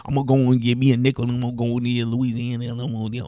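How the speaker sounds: put-on fast, run-together Louisiana accent; Louisiana accent impression. Mocking how Florida people talk, fast Louisiana accent. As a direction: rush the words in a Louisiana mumble. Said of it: "pretty good impression".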